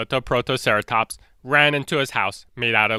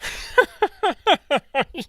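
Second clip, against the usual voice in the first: high cartoon monster cackle; villain laugh. T-Rex laughs at the stick house, high cackle. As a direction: gloat with a silly laugh.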